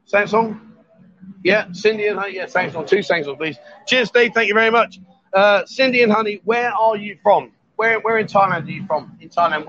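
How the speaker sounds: normal talking voice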